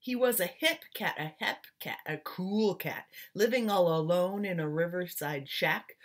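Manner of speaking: put-on lively rhythmic read-aloud, like reading to children; story narration. A narrator's reading. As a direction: swing the rhymes like a jazz storyteller. Reads picture book aloud.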